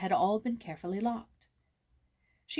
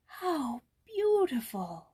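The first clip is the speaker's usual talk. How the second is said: high, slow, drawn-out wonder; awed cry; 5 semitones higher, much slower than usual. A narrator's reading. Joyce seeing the furnished room, awed cry. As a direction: breathe it out in awe. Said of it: "cried Joyce".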